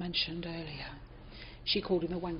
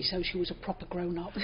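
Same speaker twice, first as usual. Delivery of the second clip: ordinary delivery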